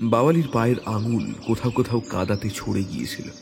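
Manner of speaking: ordinary delivery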